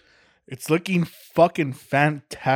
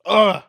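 loud annoyed groan; frustrated groan; 5 semitones higher, 10 dB louder than usual. Real, not acted. Groaning at himself after botching the read. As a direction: groan at your own mistake.

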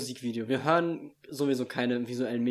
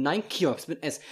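normal talking voice

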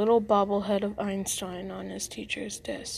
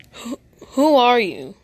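louder, slow, stammering fright; scared stammer; 3 semitones higher, 9 dB louder than usual. Acted. Shoved into his locker, Ben asks the stranger in loud slow fright. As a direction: blurt it out slowly, startled and afraid.

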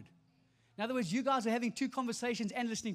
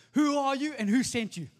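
loud, forceful interrogator's demand; hard-hitting interrogation; 3 semitones higher, 9 dB louder than usual. Acted. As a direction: question a prisoner with force. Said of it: "they ask them two questions".

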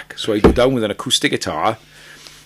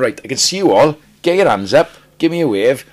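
loud, higher friendly stage call; calling out to crowd; 6 semitones higher, 8 dB louder than usual. Retold or imitated. Singer retelling his stage call to the crowd: loud friendly call. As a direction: call up to the back of the arena. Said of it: "So I'm going".

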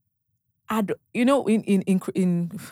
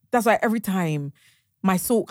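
ordinary talk

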